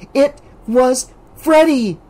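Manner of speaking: high, slow insistence; insisting. A narrator's reading. Alice insisting to Debbie. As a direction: press the point. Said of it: "Alice insisted".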